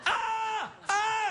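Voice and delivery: high, shouted yelps of alarm; panicked shout. Retold or imitated. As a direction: yell in fright, pointing. Buddy yelling, pointing at cornflakes.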